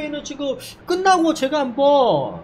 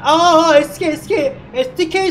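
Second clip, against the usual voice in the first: excited shout; 4 semitones higher, 5 dB louder than usual. Real, not acted. Crash seen in live race.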